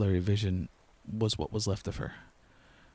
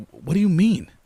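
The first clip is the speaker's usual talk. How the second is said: much higher, very loud panicked shout; 9 semitones higher, 11 dB louder than usual. A narrator's reading. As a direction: scream the question in horror. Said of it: "I started shouting".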